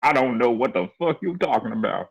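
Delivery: normal talking voice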